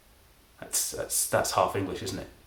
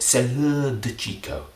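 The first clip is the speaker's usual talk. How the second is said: French phrase; 4 semitones higher, 4 dB louder, slower than usual. Shown as a demonstration. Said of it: "I think that's it".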